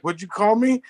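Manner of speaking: high-pitched offended challenge; mock upset. Buddy takes offence at the London clerk, mock-upset high voice. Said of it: "my buddy gets all upset".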